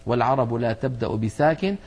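ordinary delivery

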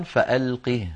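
فألقه halted on its ha; pausal sukun on ha. Shown as a demonstration. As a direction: stop on فألقه with a vowelless ha. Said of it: "ولو وقفنا عليها أيضًا".

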